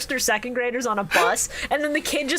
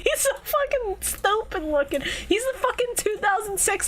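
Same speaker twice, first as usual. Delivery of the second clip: higher, giggly teasing; amused mocking; 10 semitones higher than usual. Real, not acted. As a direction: tease him with a laugh in the voice. Mocking friend, high voice.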